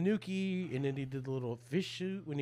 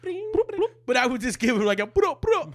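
excited retelling; 9 semitones higher, 6 dB louder than usual. Real, not acted. Excited retelling of Mario noise.

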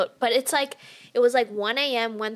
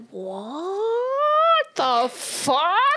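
high, drawn-out mock shock; stunned disbelief; 8 semitones higher, much slower than usual. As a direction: stretch it out slow and high, incredulous. Retold reaction to his buddies' arrival.

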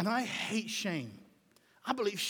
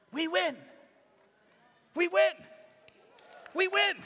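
each "We win" higher and louder; triumphant building cry; 7 semitones higher, 4 dB louder than usual. Acted. Preacher rallying the church, rising triumphant cry.

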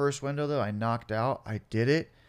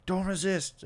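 raised-pitch mock cop order; lightly acted arresting officer; police command; 8 semitones higher, slower than usual. Cop holding man on hot blacktop, mock command.

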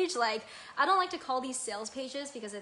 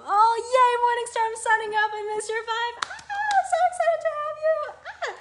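very high, loud, gushing squeals with laughter; bursting with joy, squeal it out; thrilled squealing; 14 semitones higher, 11 dB louder than usual. Real, not acted. Host squealing with joy as a listener signs up. Welcomes listener Morningstar signing up.

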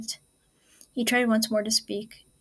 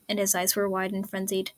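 usual speaking voice

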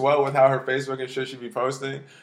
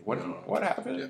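confused; 5 semitones higher, 10 dB quieter than usual. Retold or imitated. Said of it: "I was like".

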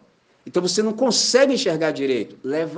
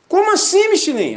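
much higher and louder, disbelieving; shocked question; 14 semitones higher, 6 dB louder than usual. Retold or imitated. Stranger shocked to learn Michelin is a pastor, high and loud. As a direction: jump up in pitch in disbelief.

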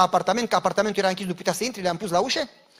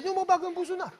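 high-pitched voice for a stubborn hand; talking hand; 11 semitones higher than usual. Acted. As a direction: squeak out a comic refusal. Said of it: "Și a zis".

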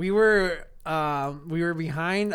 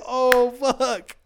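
caught red-handed; 7 semitones higher, 5 dB louder than usual. Retold or imitated. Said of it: "we're like".

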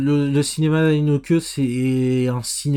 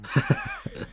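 high laugh; 8 dB quieter than usual. Real, not acted. Reacts to Striptease comparison.

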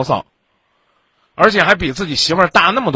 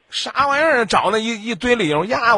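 incredulous; 7 semitones higher than usual. Real, not acted. Incredulous outburst.

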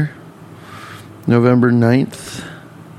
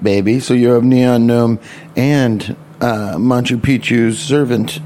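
normal talking voice

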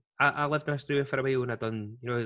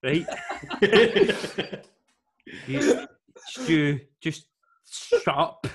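louder, higher "shut up" with a laugh; mock shout back; 5 semitones higher, 7 dB louder, much slower than usual. To the heckler at the game, a loud laughing shut up.

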